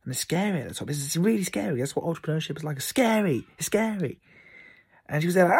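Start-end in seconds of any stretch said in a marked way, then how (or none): none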